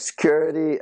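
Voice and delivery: high, sing-song call for the guards; mock call for security. Teasing a mean girl, a high mock call for security.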